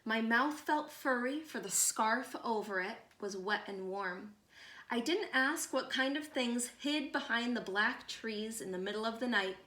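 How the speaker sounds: ordinary delivery, nothing marked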